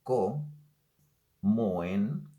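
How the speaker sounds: "co-, mo-, en" split into separate syllables with pauses; teacher splitting "co-, mo-, en" into syllables. Shown as a demonstration. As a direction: sound out "co-, mo-, en" one syllable at a time.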